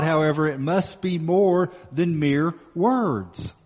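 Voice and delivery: ordinary delivery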